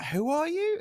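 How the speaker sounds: startled question. Retold or imitated. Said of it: "And I was like".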